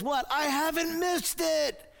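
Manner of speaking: high-pitched, astonished relief, acted out; hammed-up joyful disbelief; Ebenezer Scrooge. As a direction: play a man amazed at his reprieve, voice shooting up high. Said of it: "Ebenezer Scrooge realizes".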